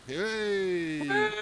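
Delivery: happy hooray; excited cheer. Real, not acted.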